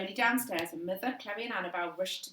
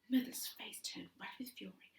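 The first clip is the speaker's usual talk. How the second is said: whispered narration; 7 dB quieter than usual. A narrator's reading. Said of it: "whispered narration".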